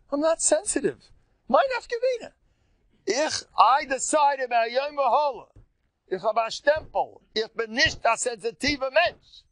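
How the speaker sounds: triumphant high-pitched declaration; proud declaration. Shown as a demonstration. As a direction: announce the vow with fervor. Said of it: "declaring his resolution".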